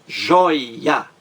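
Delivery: joia split as JOI-a; syllable by syllable. Shown as a demonstration. Teacher counting two syllables, joia said beat by beat. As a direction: break joia into two beats, first one drawn out. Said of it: "duas sílabas".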